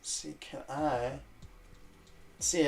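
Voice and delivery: usual speaking voice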